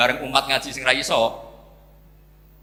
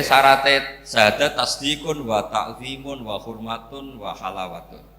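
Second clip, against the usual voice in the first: slow, loud Arabic recitation; 5 dB louder, slower than usual. Acted. Preacher reciting Arabic text to the congregation, slow and loud. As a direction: recite each word slowly and loudly.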